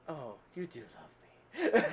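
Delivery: slow line through laughter; laughing. Real, not acted. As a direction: say it slowly while laughing. Laughing at wife's joke.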